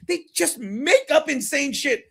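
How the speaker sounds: ordinary talk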